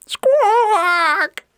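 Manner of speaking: one long, piercing squawk; very high, loud bird cry; parrot squawk. Acted. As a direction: screech like a noisy parrot. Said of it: "Pedro's squawks".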